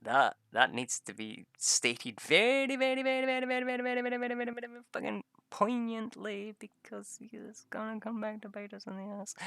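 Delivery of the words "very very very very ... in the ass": very high, run-on repetition; comic over-emphasis. Real, not acted. Player warning it will come back, comic over-emphasis.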